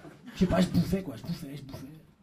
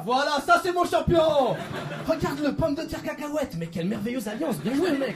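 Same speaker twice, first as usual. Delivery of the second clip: proud cheering coach; 6 semitones higher than usual. Retold or imitated. Cholesterol cheering him on.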